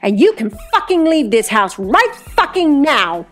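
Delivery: high-pitched seething order; gritted teeth. A narrator's reading. Brianna throws the waiter out of her house, gritted fury. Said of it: "she gritted through her teeth".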